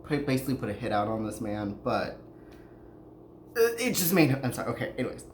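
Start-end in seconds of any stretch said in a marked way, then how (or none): none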